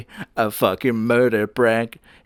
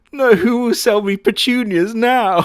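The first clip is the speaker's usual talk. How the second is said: mock grief; 11 semitones higher, 5 dB louder than usual. Mourning demon florist, sad music.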